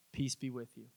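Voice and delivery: Jesus calming his frightened disciples, low and soft. Retold or imitated. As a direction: soothe the frightened disciples gently. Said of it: "He just calms everybody down".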